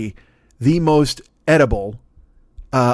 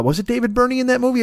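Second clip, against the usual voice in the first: ordinary delivery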